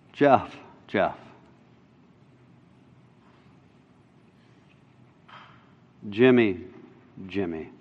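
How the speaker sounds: low, soft, gentle calling of a name; Jesus calling a person by name, soft and tender. Retold or imitated. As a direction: call the name softly, kindly.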